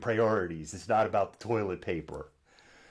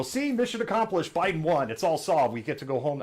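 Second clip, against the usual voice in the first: mock protester; 6 semitones higher, 4 dB louder than usual. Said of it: "they're gonna go home to go".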